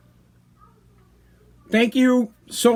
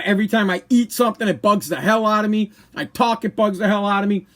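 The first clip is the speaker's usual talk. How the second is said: ordinary talk, nothing marked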